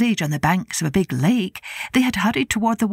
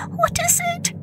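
very high, small squeak of a voice; piping little mouse question; squeaking tiny mouse. A narrator's reading. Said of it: "the tiniest mice squeaked".

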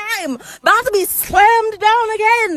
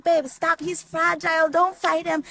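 put-on soft drunk plea; 8 dB quieter than usual. Drunk girl soothes armed attacker.

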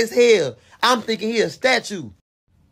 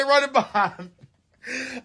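scared; 7 semitones higher, 4 dB quieter, much slower than usual. Retold or imitated. Clown chasing him.

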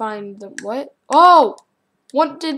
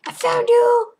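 much higher, put-on sing-song gotcha; gleeful gotcha; 12 semitones higher, 6 dB louder than usual. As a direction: squeal it like a cartoon villain. Catching the hider.

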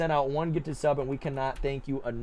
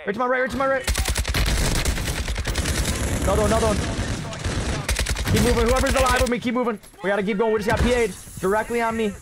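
hyped gamer bro; 6 semitones higher, 3 dB louder, faster than usual. Real, not acted. Calling out mid-firefight.

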